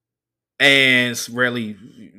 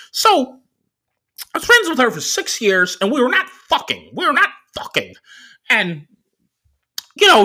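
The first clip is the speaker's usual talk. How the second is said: much higher, louder nerdy driver voice; the driver's voice; 14 semitones higher, 5 dB louder than usual. Retold or imitated. Comedian doing the driver's voice for the crowd, high and squeaky.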